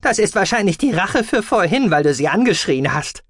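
high creature voice, needling; Gucky. A narrator's reading. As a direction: pipe it up as a small creature telling someone off. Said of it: "meinte Guki". (hohe, vorwurfsvolle Figurenstimme)

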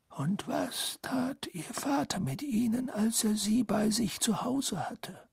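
hushed urgent interrogator whisper; whispered questioning. Acted. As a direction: ask it in a breathy whisper.

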